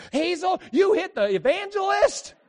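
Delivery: very high, loud drawn-out outcry; scandalized ladies. Retold or imitated. Church ladies rebuking Hazel for the punch, high scandalized shout. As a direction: shriek it like appalled church ladies. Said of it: "All the ladies go".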